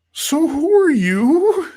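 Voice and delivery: very high, slow, cautious question; tentative courage. Retold or imitated. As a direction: act out timidly working up to asking. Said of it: "finally I get the courage to be like".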